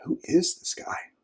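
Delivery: soft, slow, breath-heavy question; awed hush. Retold or imitated. Disciples asking about Jesus again, hushed awe. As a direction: ask it in a hushed, wondering near-whisper.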